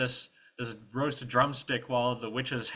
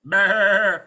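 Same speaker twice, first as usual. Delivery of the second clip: high, loud wobbling bleat; goat bleat; 11 semitones higher, 15 dB louder than usual. Acted. Imitates the goat.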